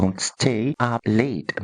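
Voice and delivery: "stay" drawn out and stressed, very slow. Shown as a demonstration. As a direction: lean on "stay" slowly. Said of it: "أنا قلت stay". (التشديد على "stay" ببطء)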